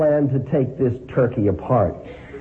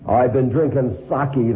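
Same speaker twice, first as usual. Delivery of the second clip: usual speaking voice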